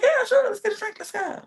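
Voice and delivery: very high, airy quote voice; mock girlish agreement. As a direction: imitate her agreeing, high and softening. Voicing Sierra agreeing in retelling.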